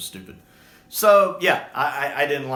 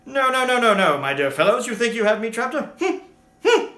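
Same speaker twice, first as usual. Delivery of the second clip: put-on louder, higher fussy old gentleman; indignant first Doctor; 8 semitones higher, 5 dB louder than usual. Fan imitates the first Doctor brushing off his captors, indignant. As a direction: bristle like an affronted old man, then hum smugly. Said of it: "the first Doctor would just be indignant".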